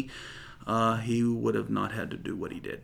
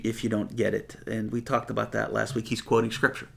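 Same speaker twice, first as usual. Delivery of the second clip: ordinary delivery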